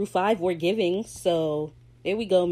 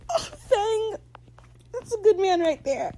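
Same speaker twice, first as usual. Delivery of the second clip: high, cracking fond cry; gushing; 14 semitones higher than usual. Real, not acted. Fan gushing about Fang, voice going high. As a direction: swoon over him. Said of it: "gushing over Fang".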